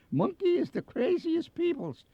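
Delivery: high, exaggerated Bavarian-accented announcer. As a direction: squeeze out a hammed-up Bavarian accent. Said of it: "with a rich Bavarian accent".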